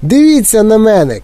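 high excited shout while running. Acted. Mike shouting to everyone as he runs. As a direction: yell it, showing off. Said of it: "кричав він на бігу".

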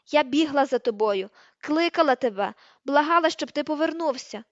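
loud, hammered reproach; angry accusation. A narrator's reading. Hermione reproaching Ron for leaving, loud and angry. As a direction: hurl the reproach at him loudly.

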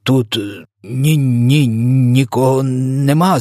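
nervous halting stammer, pitch jumping up. A narrator's reading. Frightened giant denies hiding anyone, stammering. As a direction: stumble through the denial, dragging it out. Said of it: "затинаючись".

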